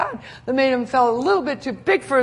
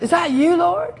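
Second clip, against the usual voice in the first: surprised Peter; 8 semitones higher, 5 dB louder than usual. Retold or imitated.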